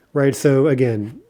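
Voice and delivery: ordinary talk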